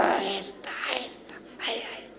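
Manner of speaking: whispered. Acted. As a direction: whisper it, fading away. Old Zulekha approaching slowly.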